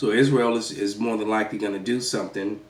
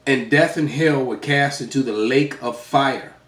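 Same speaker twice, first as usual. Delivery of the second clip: scripture reading; 3 semitones higher, 5 dB louder than usual. Acted. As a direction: read it like a preacher. Reads scripture aloud.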